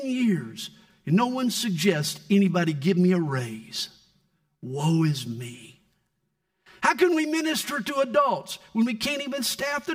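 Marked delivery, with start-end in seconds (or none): 4.6-5.8 self-pity. Pretend. Mocking pastors' self-pity.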